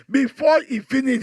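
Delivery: usual speaking voice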